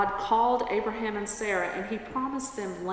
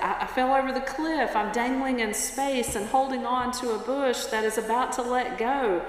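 desperate pleading; 4 semitones higher than usual. Acted. Dangling tourist explains the fall to the voice above, desperate pleading. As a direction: plead for help in mock panic.

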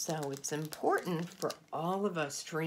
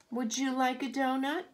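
panda asking; 7 semitones higher, 5 dB louder than usual. Acted. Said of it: "he asks the pretty bird".

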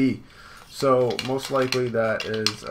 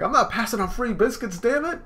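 high, loud, dig in; Luffy shouting about free biscuits; stubborn Luffy; 12 semitones higher, 5 dB louder than usual. Acted. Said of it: "And Luffy's like".

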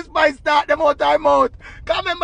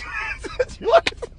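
cracking up, squealing high; high-pitched amused cackle; high helpless laugh; 3 dB quieter than usual. Real, not acted. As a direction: burst out laughing after the impression. Cracks up at own imitation.

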